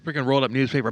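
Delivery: usual speaking voice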